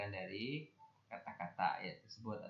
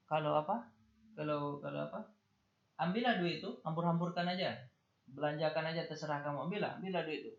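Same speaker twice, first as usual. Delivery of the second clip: sarcastic angry parent; 6 semitones higher than usual. Retold or imitated. Teacher playing an exasperated parent scolding about money, sarcastic. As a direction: sneer it like a parent daring the kid to waste the money. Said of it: "Atau dia mengatakan begini".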